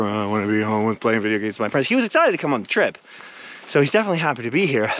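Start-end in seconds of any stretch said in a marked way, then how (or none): 0.0-1.9 lightly voiced sulky teen; a hint of a bored, whiny teen; grumpy teenager. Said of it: "the grumpy teenager".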